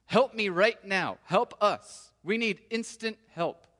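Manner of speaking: loud, slow cry for help now; father crying out to Jesus for instant help; urgent plea. Retold or imitated. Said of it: "It's in the present imperative".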